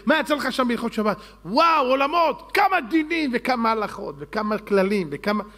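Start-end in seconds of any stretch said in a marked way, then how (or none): 1.4-5.4 acted amazement. Acted delight at what he learned.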